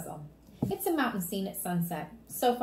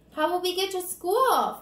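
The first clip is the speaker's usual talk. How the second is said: put-on high, louder childish whine; pouting; 10 semitones higher, 7 dB louder than usual. Fudge asking his parents about school, pouting. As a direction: pout it like a sulky little boy.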